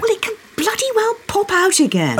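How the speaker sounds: high, loud scolding; indignant. Acted. Wife scolding husband for spoiling the mood, indignant. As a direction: order it out, pitched right up.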